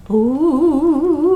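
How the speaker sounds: high, eerie moaning ooh; ghostly ooh.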